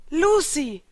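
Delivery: high, loud call of a name; joyful-angry shout; half glad, half furious. A narrator's reading. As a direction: call out, half delighted and half raging. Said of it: "rief Natsu halb erfreut, halb rasend".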